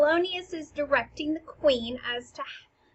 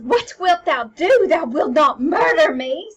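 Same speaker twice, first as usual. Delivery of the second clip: loud, raised-pitch alarmed cry; theatrical frightened outburst; upset; 4 semitones higher, 12 dB louder than usual. Acted. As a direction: protest in acted panic. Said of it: "she gets upset and goes".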